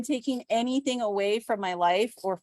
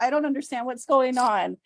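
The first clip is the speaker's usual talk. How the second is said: ordinary talk, nothing marked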